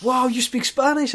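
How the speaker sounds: imitated astonishment at a discovery; surprised reaction. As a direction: act out a delighted double take. Acting out a surprised house viewer.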